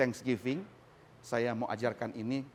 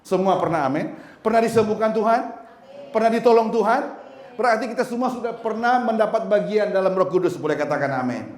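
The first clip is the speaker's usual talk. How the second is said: loud, high, emphatic preaching; full-force preaching; 6 semitones higher, 9 dB louder than usual. Acted. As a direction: preach it out to the congregation with fire.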